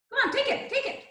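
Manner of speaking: high, laughing encouragement; laughing urging. Real, not acted. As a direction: coax them on with a laugh. Urging class to grab towels.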